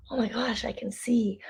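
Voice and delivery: hushed, grateful whisper; awed whisper. Shown as a demonstration. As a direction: whisper it like a small miracle. Grateful wonder demonstrated.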